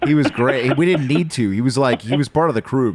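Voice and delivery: loud, high eager praise; enthusiastic. Real, not acted. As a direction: praise him with excitement.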